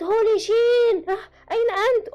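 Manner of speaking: high, drawn-out cry for him; hammed-up shout, loud and high; acted scream. A narrator's reading. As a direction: call out in mock panic. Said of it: "فصرخت وقالت".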